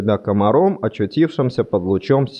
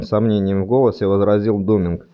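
ordinary delivery, nothing marked

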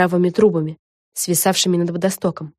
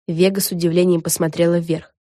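ordinary delivery, nothing marked

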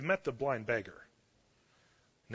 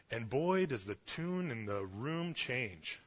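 ordinary talk, nothing marked

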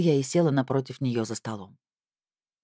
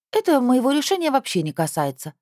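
put-on young girl, higher and defiant; teen girl voice; 9 semitones higher than usual. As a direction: pitch up for a stubborn teenager. Teen girl Anzhelika's lines.

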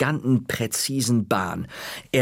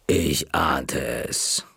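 low, slow, menacing whisper; slow librarian whisper; 7 semitones lower, much slower than usual. Acted. The librarian whispers to the caught boy, low, slow and menacing. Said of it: "sagte Knolle langsam".

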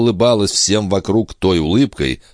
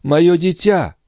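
high anguished cry of a mother; babbling cry; 12 semitones higher, slower than usual. Acted. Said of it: "лепетала Андреа".

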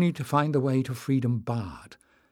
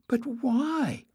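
much higher, squeaky voice; squeak; 13 semitones higher than usual. A narrator's reading. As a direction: rise to a frightened squeak. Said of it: "his voice rising to a squeak".

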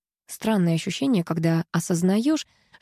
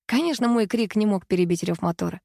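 usual speaking voice

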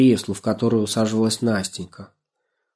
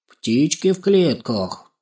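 slow, higher musing; pondering; 6 semitones higher, slower than usual. A narrator's reading. Crow trying to recall the caged birds, pondering. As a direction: draw it out while searching the memory. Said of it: "задумалась Карлуша".

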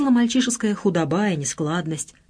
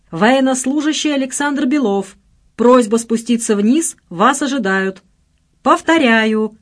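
raised, formal public-address parody; mock station announcer; 6 semitones higher, 4 dB louder than usual. A narrator's reading. Friend summoning the soldier by megaphone, mock announcer. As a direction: announce it like an airport loudspeaker. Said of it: "подражая своеобразным интонациям диктора на вокзале".

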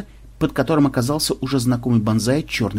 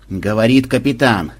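ordinary delivery

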